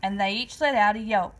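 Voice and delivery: ordinary delivery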